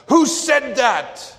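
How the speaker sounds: very high, loud, slow bellow; furious scream. Retold or imitated. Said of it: "a third time he pounded upon the table and screamed".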